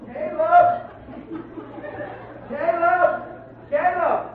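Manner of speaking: very high, drawn calls of a name; high, thin mock holler at normal volume; mock distant hollering. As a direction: imitate searchers hollering for a lost child. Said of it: "heard what they was hollering".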